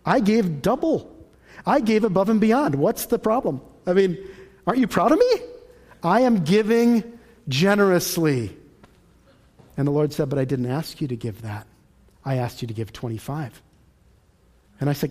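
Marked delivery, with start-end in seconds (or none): 0.1-8.6 raised pitch, hammed-up self-justifying; mock indignant protest. Giver defends his double gift to God, mock indignant. 10.3-13.6 low, soft imitated voice of God; calmly insist, quiet and low; God patiently correcting. God answers the protesting giver, patient and quiet.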